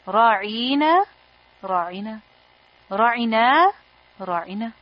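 "Ra'ina" repeated with the vowels stretched to different lengths; drawn out vowels. Shown as a demonstration. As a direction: say "ra'ina" four ways, lengthening the long vowels differently each time. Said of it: "depending on how you stretch the vowels".

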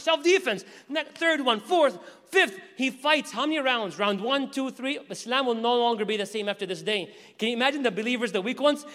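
ordinary delivery

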